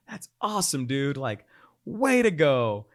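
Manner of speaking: loud, slow, cheering approval; enthusiastic. Retold or imitated. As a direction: congratulate him warmly. Retold friend reply.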